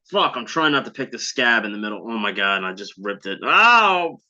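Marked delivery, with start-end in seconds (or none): 3.4-4.2 high, loud cry of pain; startled "oh" shooting up high; real pained yelp. Real, not acted. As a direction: cry out from a sudden sting. Rips the scab, cries out.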